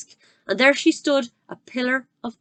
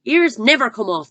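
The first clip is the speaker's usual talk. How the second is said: louder scornful shout; 5 semitones higher, 8 dB louder than usual. Acted. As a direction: shout her down. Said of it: "the Trunchbull shouted".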